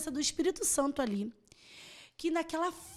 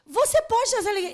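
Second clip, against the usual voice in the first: excited promise; 9 semitones higher, 7 dB louder, faster than usual. Retold or imitated. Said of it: "Eu falei".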